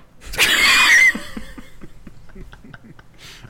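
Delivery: airy high-pitched laugh; high breathless laugh. Real, not acted. Group laughs at ending.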